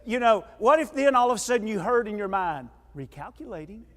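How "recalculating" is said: GPS voice. Pretend. Imagined GPS voice in your mind.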